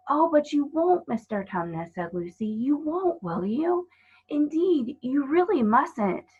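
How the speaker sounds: put-on a child begging him not to; pleading girl.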